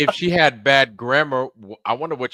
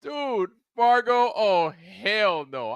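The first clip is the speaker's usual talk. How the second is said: scornful disbelief; 8 semitones higher, slower than usual. Real, not acted. Refusing to watch Fargo.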